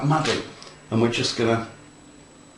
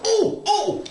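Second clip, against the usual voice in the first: excited. Real, not acted. Excited at own work.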